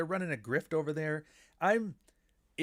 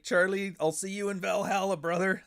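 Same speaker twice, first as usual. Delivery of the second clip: higher, louder mock tough-guy send-off; mock wrestler bravado, higher and louder; mock macho farewell; 7 semitones higher, 5 dB louder than usual. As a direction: ham it up like a wrestler promo. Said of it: "Like he's Macho Man Randy Savage".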